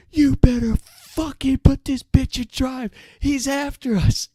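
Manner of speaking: high, loud, slow furious scream. Retold or imitated. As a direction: scream it right in his face. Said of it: "just screams".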